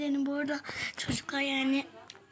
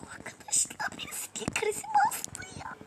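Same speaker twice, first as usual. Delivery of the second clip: hushed high whisper; shocked whisper. Real, not acted. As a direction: whisper it in alarm.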